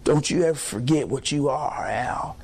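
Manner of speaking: imitating a low, hushed word from God; God speaking to Al. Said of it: "he's weeping and he said".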